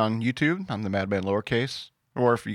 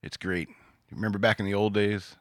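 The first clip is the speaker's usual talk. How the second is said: normal talking voice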